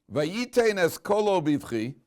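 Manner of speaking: slow, high, loud Hebrew declaiming; verse recitation. Acted.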